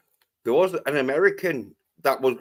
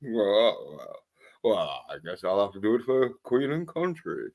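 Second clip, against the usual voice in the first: mock Roger Moore; 6 semitones lower, 6 dB quieter than usual. Moore voiced, low dutiful.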